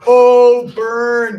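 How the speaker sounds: loud, high mock "oh, burn"; hammed-up playground taunt. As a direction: blurt it like a crowd reacting to a roast. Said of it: "we all kinda go like".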